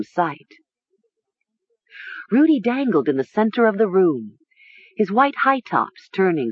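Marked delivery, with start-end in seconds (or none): none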